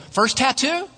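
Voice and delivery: high, rising casual question; breezy question. Acted. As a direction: toss it up high as a friendly check. Tattoo artist greets client in ad.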